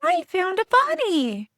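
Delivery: put-on high, slow small-child voice; a tiny boy announcing his find; little kid voice. A small boy calls out to the adults, in a high little child's voice. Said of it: "little voice go".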